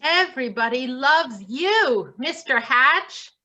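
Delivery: ordinary delivery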